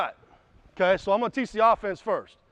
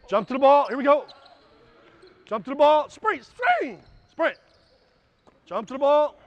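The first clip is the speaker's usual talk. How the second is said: loud, higher calls to the players; yelling commands; 5 semitones higher, 4 dB louder than usual. Real, not acted. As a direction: shout the cue so the floor hears it. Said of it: "And I'm yelling what to do".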